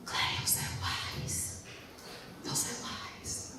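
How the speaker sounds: soft, slow whisper to herself; whispered aside. Real, not acted. Speaker privately praising her mum, slow whisper. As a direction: murmur it slowly, almost unvoiced.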